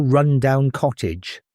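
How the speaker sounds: ordinary delivery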